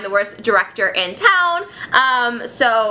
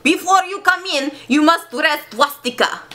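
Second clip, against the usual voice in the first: hammed-up German accent, opening high; 3 semitones higher than usual. Playwright ordering visitors before entry, German accent. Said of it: "This is German".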